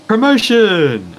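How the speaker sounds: high, loud, drawn-out triumphant shout; excited cheer. Real, not acted. Co-host left, he celebrates becoming main commentator, excited shout. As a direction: cheer the word out slowly like a victory.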